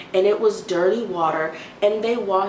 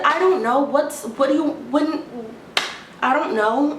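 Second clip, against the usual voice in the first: pitched-up confused protest; flustered outburst; 7 semitones higher than usual. Retold or imitated. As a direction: blurt it out, baffled. Flustered reply to clerk.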